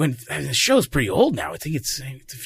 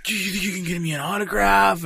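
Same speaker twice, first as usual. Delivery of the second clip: put-on excited kid; 7 semitones higher than usual. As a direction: gush like a thrilled kid. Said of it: "He's like".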